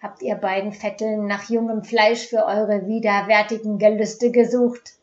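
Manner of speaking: loud, sneering accusation; angry accusation. Acted. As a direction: spit the words at them in disgust. Angry mob accuses the two women.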